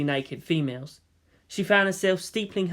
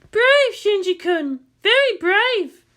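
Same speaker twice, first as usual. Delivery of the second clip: much higher, slow teasing woman's voice; Misato voice; 6 dB louder, slower than usual. Acted. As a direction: tease him slowly from up high. Misato's voice in story.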